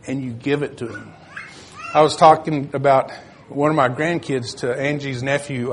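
normal talking voice

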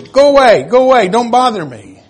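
loud, high impatient go away; annoyed brush-off. Pretend. Parent shooing away a pestering child, loud and impatient. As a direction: shoo the child off sharply.

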